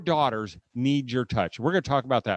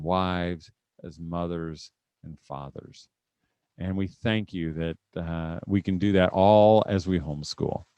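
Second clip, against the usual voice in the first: earnest prayer voice, measured and lower; 3 semitones lower than usual. Acted. Speaker leads parents in a closing prayer, earnest and measured. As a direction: lead the room in a closing prayer.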